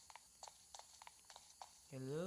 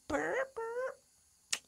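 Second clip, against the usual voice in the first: silly noise; 4 dB louder than usual. Pretend. Silly noise after winning.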